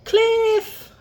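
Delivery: high loud alarmed yelp. Acted. Olive spots the cliff ahead, loud high yelp. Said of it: "Olive yelped".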